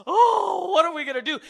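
high, loud mock panic. Pastor mocking a panicking believer, high and loud. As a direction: ham up a frightened believer's wail. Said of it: "rise up ... and go".

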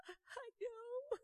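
high tearful wail; a sobbing woman, high and wet; crying. Retold or imitated. Lady at the altar, crying as she answers. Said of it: "Started crying big crocodile tears".

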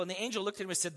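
ordinary talk, nothing marked

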